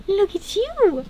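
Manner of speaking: high, soft cooing. Real, not acted. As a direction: coo at the cat. Cooing to the cat.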